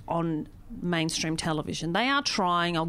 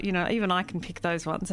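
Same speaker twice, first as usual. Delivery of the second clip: normal talking voice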